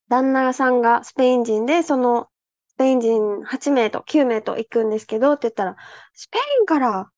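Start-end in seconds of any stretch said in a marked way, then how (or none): 6.1-7.1 imitating old man's surprise. Old man reacts to Spanish guests.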